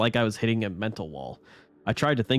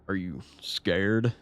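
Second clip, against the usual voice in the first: slow, flat, sinister question; flat creepy voice; much slower than usual. Acted. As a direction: drag it out, eerily calm. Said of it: "Now he just sounded flat".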